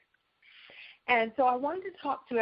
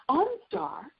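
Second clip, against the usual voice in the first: higher, falling chime on OnStar; 8 semitones higher than usual. Shown as a demonstration. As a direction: announce OnStar like the car system. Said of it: "that's how they do the talk".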